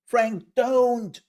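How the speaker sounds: high, loud cry of warning; mock alarmed shout. Streamer warning Frank about the oven, mock alarmed shout. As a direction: shout to stop him in playful panic.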